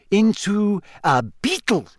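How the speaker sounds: high, savoured punchline of a reveal; suspenseful, triumphant finish; quiet triumph. A narrator's reading. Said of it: "trembled with quiet triumph".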